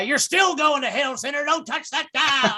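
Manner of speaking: high-pitched mock hellfire shout; hammed-up damnation, pitched high; screaming old evangelist. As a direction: imitate a ranting radio preacher. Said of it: "it's the same guy".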